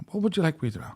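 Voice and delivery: lightly voiced posh hostess; mock air hostess. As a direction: put on a sweet hostess voice.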